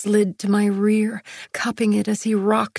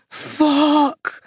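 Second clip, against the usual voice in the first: growled; 5 semitones higher than usual. A narrator's reading. Man overcome with his lover, strained growl. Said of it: "he growled".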